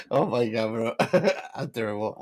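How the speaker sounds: normal talking voice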